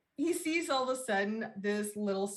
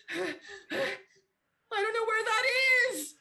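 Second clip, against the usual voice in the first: mock panicked cry; 13 semitones higher, 4 dB louder, much slower than usual. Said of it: "the guy's like".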